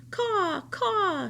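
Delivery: high cawing; crow caws. Acted. The crow cawing at the dazed rabbit. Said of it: "said the Pellegrina crow".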